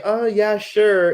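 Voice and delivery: lightly voiced eager tourist; clueless tourist. Naive tourist agreeing to hold a baby, mildly bright. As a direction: agree brightly without thinking. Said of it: "You might say".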